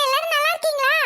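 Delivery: ordinary delivery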